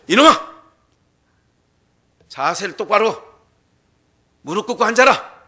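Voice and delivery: put-on old schoolmaster barking at a slouching pupil; hammed-up strict teacher; stern scolding.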